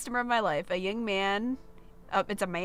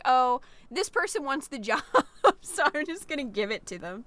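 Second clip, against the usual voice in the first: higher, put-on quote of a pushover; mock someone giving away the job; lightly hammed-up imitation; 5 semitones higher than usual. Mocking hypothetical hiring quote.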